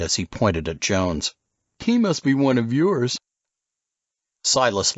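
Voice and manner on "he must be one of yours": high, lazy surfer-dude voice; stoned surfer dude. Acted. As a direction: play the bloodshot California surfer. Casey teasing.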